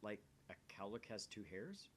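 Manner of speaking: usual speaking voice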